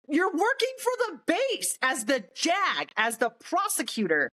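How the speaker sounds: high-pitched, indignant rant; outraged disbelief. Retold or imitated. Sergeant confronting counsel who is also prosecutor, outraged. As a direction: challenge the conflict of roles in exasperated disbelief.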